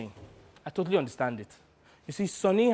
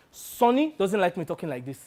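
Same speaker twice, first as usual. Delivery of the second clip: normal talking voice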